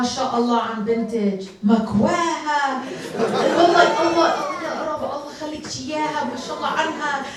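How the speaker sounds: high, fast imitation of admiring women; gushing aunties. Mother's friends praise her daughter at the masjid, gushing. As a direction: mimic mom's friends heaping praise.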